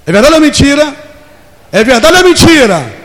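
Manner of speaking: loud high call to the crowd; preaching shout. Real, not acted. Preacher challenges the congregation, loud and high. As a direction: shout it out to the whole church.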